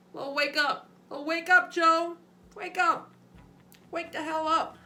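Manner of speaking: high, urgent self-rousing voice; Joe Biden. Acted. Mimicking a politician telling himself to wake up, high-pitched. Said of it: "cue to himself to wake up".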